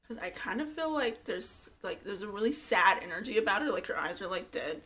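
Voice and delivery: usual speaking voice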